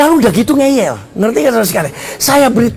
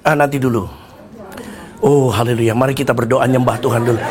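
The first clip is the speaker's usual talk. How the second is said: very low, softer mock-divine voice; Tuhan; 12 semitones lower, 5 dB quieter, faster than usual. God putting off a drowning man's plea, low mock-pious voice. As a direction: drop deep, in no hurry to help. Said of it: "Atau Tuhan bilang".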